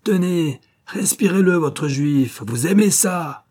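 high, loud, vicious taunt; enraged taunting. A narrator's reading. Officer pushing Louis's face against the corpse, raging. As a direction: force it on him in angry bursts. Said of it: "Dans sa rage".

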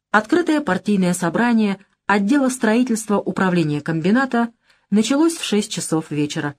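ordinary delivery

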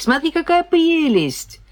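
high, delighted squeal; excited. Acted. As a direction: gush over something cute. Twins show cute bottles.

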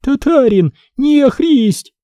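put-on high, frightened shout of a small girl; a child shrieking in fear; girl's scream. A narrator's reading. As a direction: cry out in terror at the stranger. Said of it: "закричала".

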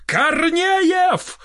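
high-pitched outraged cry; yelling. A narrator's reading. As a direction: wail the name in a high voice. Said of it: "завопил Толстый". (высокий возмущённый вопль)

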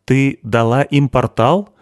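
slow, emphatic, pointed question; sharp question. A narrator's reading. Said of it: "резко спросила Мерит". (резкий вопрос с нажимом)

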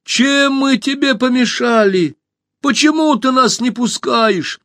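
high, slow, loud calling out; shout. A narrator's reading. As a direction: shout up to the monster. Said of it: "закричала".

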